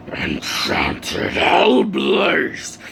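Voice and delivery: very slow, voiceless harsh attack cry; demon mode. Acted. Enraged hero unleashing fire on the monster, demonic fury. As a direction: rasp out the attack name like a demon. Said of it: "He goes full on demon mode".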